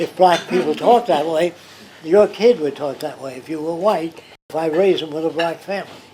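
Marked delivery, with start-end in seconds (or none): none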